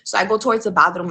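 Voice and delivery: ordinary delivery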